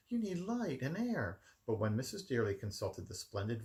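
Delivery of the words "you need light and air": nanny voice. A narrator's reading.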